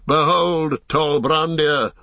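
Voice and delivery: high, drawn-out announcement; proclaiming. A narrator's reading. Aragorn pointing south to the tall peak, proclaiming. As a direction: herald the sight, lifted and slow at the end.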